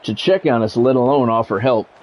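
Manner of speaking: ordinary talk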